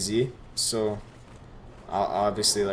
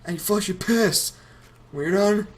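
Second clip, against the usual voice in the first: chronic stoner voice; 10 semitones higher, 4 dB louder than usual. Retold or imitated. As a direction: drag it out like a burnt-out stoner coach. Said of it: "Get ready for my chronic stoner voice".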